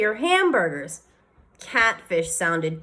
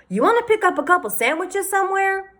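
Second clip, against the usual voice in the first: lightly voiced father, higher; a dad offering lunch, higher; cheery father; 12 semitones higher than usual. A narrator's reading.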